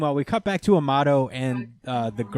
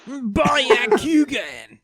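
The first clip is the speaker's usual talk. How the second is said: high, hugely stretched mock battle call; anime attack cry; 14 semitones higher, 7 dB louder, much slower than usual. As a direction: echo the attack name high, loud and very slow.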